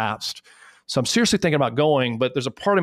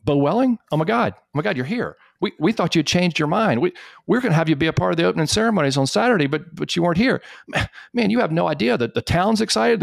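high, fast, thrilled burst; excited recognition; 7 semitones higher than usual. Retold or imitated. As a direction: suddenly realise the guest of honour is here. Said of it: "he's like".

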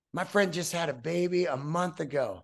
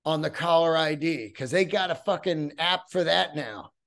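ordinary talk

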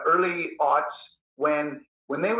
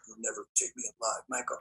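breathy hushed threat, much lower and softer; intense whisper; 7 semitones lower, 11 dB quieter, faster than usual. Retold or imitated. Hero growling a threat at his foe, hushed. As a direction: mutter the threat barely audible. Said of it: "No, I said".

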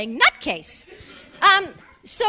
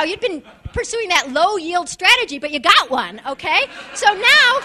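ordinary talk

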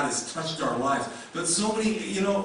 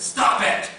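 sudden loud, high shout; acted outburst of stern correction; shouted rebuke; 10 semitones higher, 9 dB louder than usual. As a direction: shout it as a sharp scolding command. Acting God's imagined harsh rebuke.

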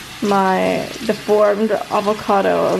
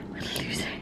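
whispered tease; 15 dB quieter, faster than usual. Real, not acted. Whispered joke.

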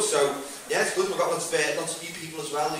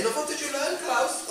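higher-pitched mock German questioner; playful, raised-pitch question; curious German back home; 9 semitones higher, 3 dB quieter than usual. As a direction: lightly act a friend quizzing the returning traveller. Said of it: "when you go back to Berlin".

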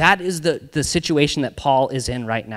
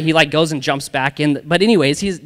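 ordinary talk

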